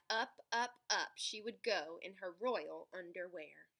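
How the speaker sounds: ordinary delivery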